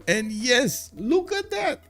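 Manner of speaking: high, triumphant commentator cry; thrilled play-by-play outburst; excited call. Real, not acted. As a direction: jump up high as you hail the big moment. Commentator hails a game event.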